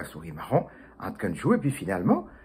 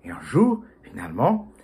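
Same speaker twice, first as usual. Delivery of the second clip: ordinary delivery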